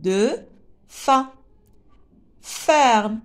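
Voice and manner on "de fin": "De fin" as two isolated beats with a pause between; split into syllables. Shown as a demonstration. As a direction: separate "De" clearly from "fin". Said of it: "Deux syllabes". (« De fin » découpé, de… fin)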